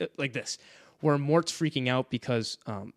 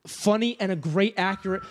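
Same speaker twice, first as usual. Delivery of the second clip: ordinary talk, nothing marked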